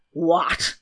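an affronted, low "what"; exclaiming. A narrator's reading. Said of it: "exclaimed the king".

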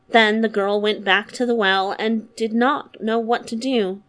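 plain reading voice

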